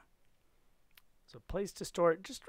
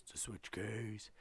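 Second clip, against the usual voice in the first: low, soft answer; quiet low echo of a catchphrase; 6 semitones lower, 8 dB quieter than usual. Pretend. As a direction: drop it down low in reply.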